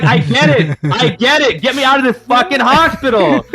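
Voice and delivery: high, loud frustrated yelling; angry retold yell. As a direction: shout it like you've been stuck for years. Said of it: "I was so mad".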